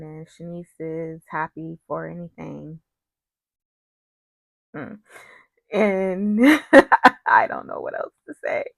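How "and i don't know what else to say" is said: laughing helplessly mid-sentence; real laugh breaking into the words. Real, not acted. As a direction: burst out laughing, then talk on through it. Laughs at own lack of words.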